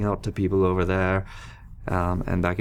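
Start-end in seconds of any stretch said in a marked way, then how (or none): none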